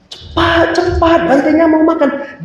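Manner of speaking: high-pitched imitated nagging adults; urgent nagging. Parents and teachers press child.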